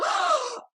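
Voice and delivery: sharp high gasp of horror; shocked gasp. Acted. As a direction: suck in air in shock. Lady Wishford reads the betrayal.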